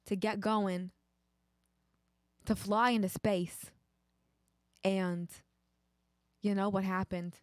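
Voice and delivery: mock Boston accent. Astronaut recounting the big day, mock Boston. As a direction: tell it in a Boston accent with long pauses.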